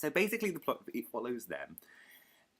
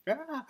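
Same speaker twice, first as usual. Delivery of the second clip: high, loud "ah" of helplessness; mock flailing cry; 6 dB louder than usual. Vlogger imitating himself beside brilliant bloggers, mock helpless cry. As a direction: let out a comic, high-pitched babbled wail. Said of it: "I'm just here going".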